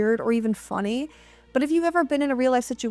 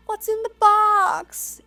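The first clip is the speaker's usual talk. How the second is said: high, drawn-out mock wail; meme imitation; 11 semitones higher, 4 dB louder, slower than usual. Detective in the meme demanding what's in the box, high mock-anguished cry. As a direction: imitate the anguished movie line, pitched way up and stretched. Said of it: "it's kind of funny how he says it".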